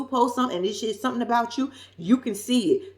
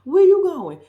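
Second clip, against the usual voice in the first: louder, higher call after him; mock shout after him; 7 semitones higher, 8 dB louder than usual. To Derek stepping out of the car, a mock shout after him. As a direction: yell at him as he walks off.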